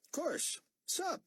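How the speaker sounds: put-on cocky young corporal. Young corporal brushing off Buck, lightly voiced and cocky. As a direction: toss it off like a bored young soldier.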